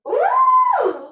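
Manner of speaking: high, loud ooh at a sour taste; sour-face squeal. Acted. Reacting to tasting sour lemon.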